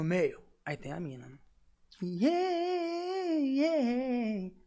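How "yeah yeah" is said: high, crying pop-singer wail; girl's voice. Retold or imitated. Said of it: "eu tô fazendo a voz da mina".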